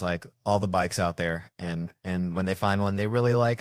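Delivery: normal talking voice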